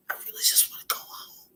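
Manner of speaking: barely voiced tearful whisper; crying, homesick. Real, not acted. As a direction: squeeze the words out through real tears. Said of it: "I'm gonna cry again".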